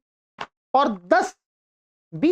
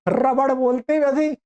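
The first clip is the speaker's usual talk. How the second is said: normal talking voice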